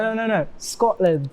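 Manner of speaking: easygoing retold correction; unoffended correction. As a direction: correct them patiently without taking offence. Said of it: "to me, it's not offensive".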